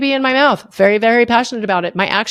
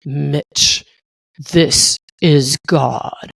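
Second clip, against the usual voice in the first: much lower and slow, a deep heavenly boom; booming voice of God; 8 semitones lower, much slower than usual. Retold or imitated. God calling down to Mitch, slow and booming. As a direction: announce yourself like God from the clouds. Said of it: "this loud, booming voice".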